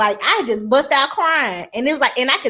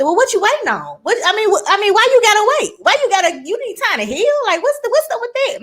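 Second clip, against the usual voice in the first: dad's disbelief; 9 semitones higher, faster than usual. Retold or imitated. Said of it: "My daddy said".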